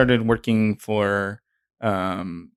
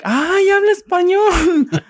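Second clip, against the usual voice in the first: very high, slow, louder mock amazement; amazed aunts; 6 dB louder, slower than usual. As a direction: gush like a surprised aunt. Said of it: "They were like".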